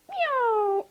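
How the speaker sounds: high, loud swooping "nyow"; loud gliding whoosh; jump zoom noise. A narrator's reading. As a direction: voice a cartoon leap through the air. Imitated noise of big jump.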